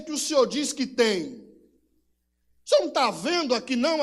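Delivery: loud, high indignant demand; angry questioning. Acted. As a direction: hurl the question back in bitter anger. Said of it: "com aquela raiva no coração".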